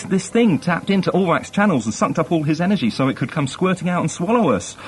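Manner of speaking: high, excitable chirpy sidekick; Villa. Acted. Host as the funny crewman quizzes crewmate, chirpy high voice. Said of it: "Villa, who's the funny one, a really witty little chirpy fellow".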